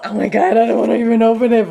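ordinary talk, nothing marked

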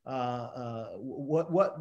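ordinary delivery

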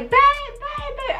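very high-pitched frantic cry for a husband; mock panicked scream. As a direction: re-enact screaming for help from your partner. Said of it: "I am screaming bloody motherfucking murder".

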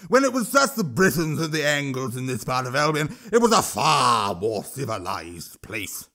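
troll voice, slowing at the end; a troll lecturing the king. Acted.

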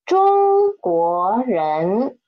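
中国人 drawn out syllable by syllable, Zhōng-guó-rén; 慢速示范; slow demo. Shown as a demonstration. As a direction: say 中国人 very slowly so students can copy. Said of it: "Read after me".

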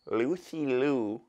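usual speaking voice

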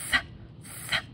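"Th" as voiceless breath; whisper-like "Th", tongue between teeth; soft TH. Shown as a demonstration. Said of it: "sounds kinda like a whisper".

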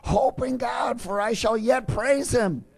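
rally yourself to hope; fighting hope. Acted. Preacher voicing the psalmist's defiant hope, shouting. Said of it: "He's saying".

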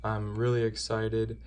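normal talking voice

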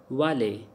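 "vale" said as "va-lay", no T; silent final T. Shown as a demonstration. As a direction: end "vale" on an open ay. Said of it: "because T is silent in the vale".

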